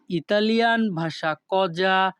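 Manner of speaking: normal talking voice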